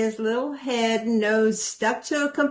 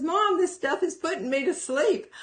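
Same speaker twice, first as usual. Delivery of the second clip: son's line lifted higher, a touch softer; voicing her son; 8 semitones higher, 3 dB quieter than usual. Mother quoting her drowsy son, lightly voiced. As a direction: lightly mimic a sleepy kid.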